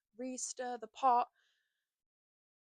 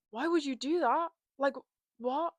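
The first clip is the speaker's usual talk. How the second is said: higher, louder upset questioning; retold tearful plea; 5 semitones higher, 5 dB louder than usual. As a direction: imitate a distressed phone call, incredulous. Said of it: "was call her crying, being like".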